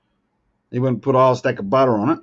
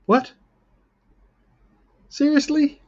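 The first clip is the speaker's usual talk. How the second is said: incredulous; 13 semitones higher than usual. Real, not acted. Disbelief at losing to Sandra.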